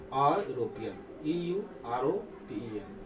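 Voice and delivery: ordinary talk, nothing marked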